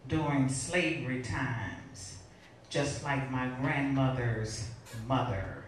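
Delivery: measured, rhythmic spoken-word reading; deliberate poetry delivery with pauses between phrases; poem recitation. Acted. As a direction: recite it as a poem, lingering on the images.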